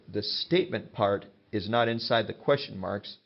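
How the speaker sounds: ordinary delivery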